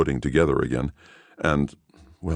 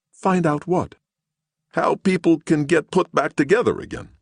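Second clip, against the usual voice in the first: high voice; 13 semitones higher than usual. Acted. Woman and Gerlich alternate.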